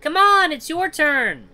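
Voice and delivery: put-on very high, loud child's voice; child calling. Child at the fence egging boy on, high goading shout. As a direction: goad a playmate in a high kid voice. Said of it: "Voices wafting across".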